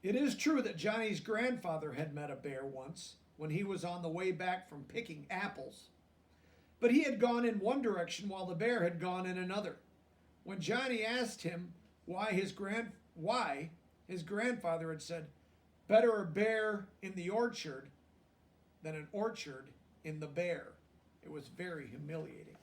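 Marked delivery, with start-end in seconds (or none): 0.0-15.3 lively storybook reading aloud; reading to children. A narrator's reading. 15.9-20.7 storybook read with weight on Better; grandfather's line read out. A narrator's reading.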